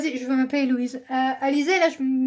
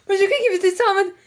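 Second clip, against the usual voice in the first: laughing; 5 semitones higher, much faster than usual. Real, not acted. Laughing while explaining her mishearing.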